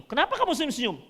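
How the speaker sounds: angel answering. Retold or imitated. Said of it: "Malaikat jawab".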